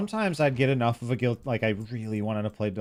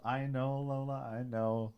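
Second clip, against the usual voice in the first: quiet, much softer aside to a pet; soft soothing; 9 dB quieter, slower than usual. Real, not acted. Streamer answering his complaining kitty, soft and soothing.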